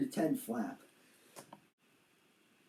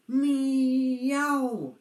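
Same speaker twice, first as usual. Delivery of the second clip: kitten meow; 7 semitones higher, 5 dB louder than usual. Acted. Kitten cries again.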